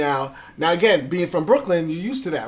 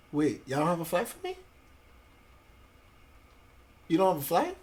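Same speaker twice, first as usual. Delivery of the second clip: put-on soft, halting disbelief of a child; hurt, quiet question; 9 dB quieter than usual. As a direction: ask softly as it sinks in. Child realizing he is left behind.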